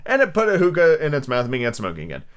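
usual speaking voice

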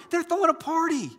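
very high, jubilant cry; celebrating. Acted. Preacher cheering someone who resisted temptation, jubilant and high. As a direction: announce a party as great news. Heaven celebrates small victory.

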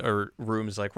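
ordinary delivery

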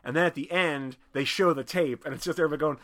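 normal talking voice

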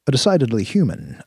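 usual speaking voice

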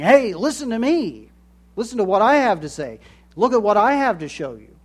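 loud, high and fast, clamouring for attention; attention-seeking voices. Retold or imitated. Preacher mimics social media voices, loud and high. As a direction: shout over the crowd for attention. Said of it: "Everybody's saying".

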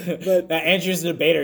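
normal talking voice

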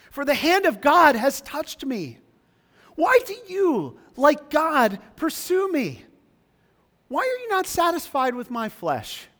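high, loud anguished plea; pleading Job. Acted. Preacher voicing Job begging his friends. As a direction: cry out for mercy from friends. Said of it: "Here Job pleads".

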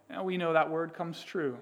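ordinary talk, nothing marked

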